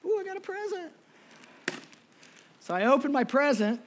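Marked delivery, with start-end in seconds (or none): 0.1-0.9 put-on child's delight. Child opening gift.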